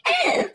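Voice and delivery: high short cackle; a quick taunting cackle; mocking cackle. Bully cackling at the girls' threat.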